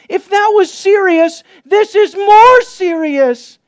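high, loud, urgent preaching; preaching at full force. Real, not acted. Pastor urging listeners not to neglect salvation, fervent preaching. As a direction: drive it home like a revival preacher.

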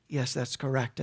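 low, quiet flat answer; a hint of Sapphira, low and quiet; Sapphira's low reply. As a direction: mutter a soft yes. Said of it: "Did you actually sell it".

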